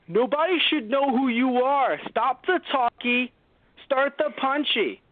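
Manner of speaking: high, slow, put-on scolding voice; mock lecturing character. Voicing a character lecturing Superman, high and slow.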